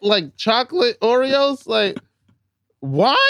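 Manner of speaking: high-pitched disgust; grossed-out and incredulous. Real, not acted. Disgust at Oreo wine.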